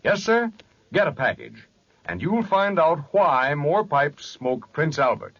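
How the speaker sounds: ordinary delivery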